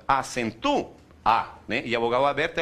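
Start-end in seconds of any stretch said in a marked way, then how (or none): 0.1-1.5 "acentua, A" said acen-TU, pause, then a lone A; vowels pulled apart. Shown as a demonstration. Teacher showing the open vowel leaving, "acentua, A" broken into hiatus. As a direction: stress TU in "acentua, A" and detach the A. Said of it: "Ela sai fora".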